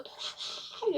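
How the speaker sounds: high, airy, voiceless cry; imitated yearning cry. People yearning for their president, imitated breathy cry. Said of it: "저들은 자기 대통령을 저렇게".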